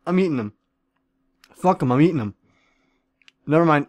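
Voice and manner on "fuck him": ordinary delivery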